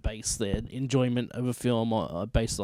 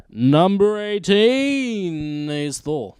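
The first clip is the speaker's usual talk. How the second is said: slow, higher, louder countdown announce; announcer voice; 6 semitones higher, 6 dB louder, much slower than usual. Acted. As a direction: call it like a trailer host.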